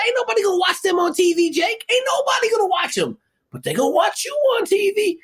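high squeaky voice, laughing through it; squeaky little executive. Retold or imitated. Executive telling Jake why he was hired, squeaky and amused. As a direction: talk up high and tickled, like the little boss. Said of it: "here come little squeaky dude".

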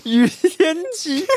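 slow, drawn-out, high mystic name; mock fortune-teller intonation. Host mock-intoning the name like a fortune-teller slowly. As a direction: intone it like a feng shui master.

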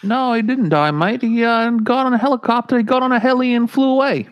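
put-on terrible Australian accent. Host rambling a plot recap: bad Australian accent. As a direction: keep the fake Aussie accent going. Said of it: "my terrible Australian accent".

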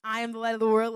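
group recall. Shown as a demonstration. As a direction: say it back together. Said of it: "the first point was what?".

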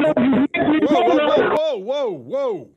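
loud, high startled cries; startled whoa. Real, not acted. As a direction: caught off guard by a blast. Pushes wrong button on air.

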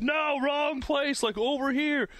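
mock worker calling out.

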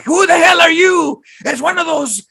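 very high, hammed-up movie bandit rant; mock bandit outrage. Retelling the falling gringos scene, high mock outrage. Imitating the bandit leader's outburst.

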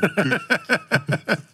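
high, loud burst of laughter; cracking up hard at the story; big laugh. Real, not acted. Laughing loudly at the collapsing library story.

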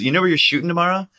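high, fast put-on voice; imitating Radio Man. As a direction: rattle it off high and quick. Radio Man voiced, quick question.

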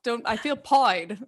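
British slang pied said pʰɔɪd, rhyming with avoid; pied with a British-style oi vowel; mock British accent. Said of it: "speak in an accent".

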